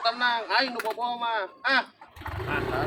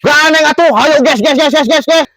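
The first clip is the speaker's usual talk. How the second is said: loud heckling; 9 semitones higher, 7 dB louder than usual. Acted. Interrupting the streamer.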